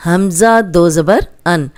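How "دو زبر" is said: normal talking voice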